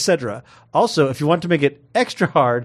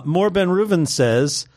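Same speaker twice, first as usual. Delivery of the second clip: normal talking voice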